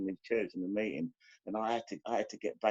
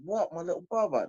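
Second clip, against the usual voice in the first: shocked disbelief; 8 semitones higher than usual. Retold or imitated. Hearing brother was killed.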